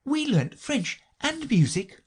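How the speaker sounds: put-on high, measured girl's voice; Alice. A narrator's reading. Alice describes her lessons, high and unhurried. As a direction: list it slowly in a high voice. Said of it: "said Alice".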